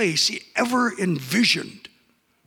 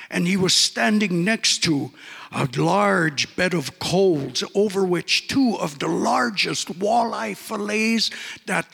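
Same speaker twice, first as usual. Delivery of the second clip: ordinary delivery, nothing marked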